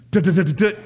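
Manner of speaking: imitated knocking. Knocking on bathroom door.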